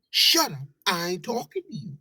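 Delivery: usual speaking voice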